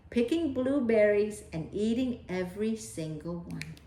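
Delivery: ordinary delivery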